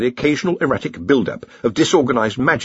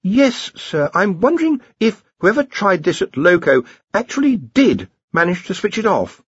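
high-pitched, anxious underling; nervous Ponder; 9 semitones higher than usual. A narrator's reading. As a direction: warn the boss in a worried high voice. Ponder in story.

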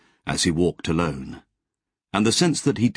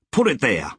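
hearty offer; 5 semitones higher than usual. Acted. Wally offers handshake.

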